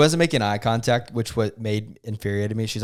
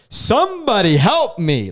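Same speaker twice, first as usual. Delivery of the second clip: high, loud, drawn-out plea; intense cry for help; 10 semitones higher, 5 dB louder, slower than usual. Retold or imitated. Passenger calling to two ladies as doors shut, intense. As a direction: call out slowly and desperately, acting it up. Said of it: "I was getting so intense".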